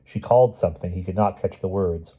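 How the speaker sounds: ordinary delivery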